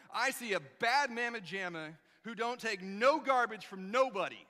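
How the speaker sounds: loud, punchy proud boast; bold declaration. Retold or imitated. Yul tells Junior who he really is, loud and proud. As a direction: hype him up with swagger.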